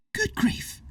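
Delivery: breathy high gasp; surprised exclaim. A narrator's reading. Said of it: "Hazel exclaimed".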